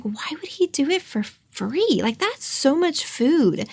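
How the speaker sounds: high and soft, like choking up; retold tearful disbelief. After the owner offered free food, a retold tearful high soft disbelief. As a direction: replay the moment she hung up in disbelief, softly. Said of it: "I remember I cried".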